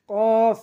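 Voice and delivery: loud, long "কফ" shoved out from the throat; pushed from throat. Shown as a demonstration. Teacher showing learners the letter, "কফ" long and pushed. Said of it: "গলা থেকে ধাক্কা দিয়ে".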